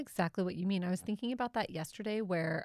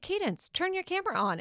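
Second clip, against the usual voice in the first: higher, insistent mock parental order; retold mom order; 10 semitones higher than usual. Mom telling daughter to switch camera on, insistent retelling. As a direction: imitate yourself ordering a kid about.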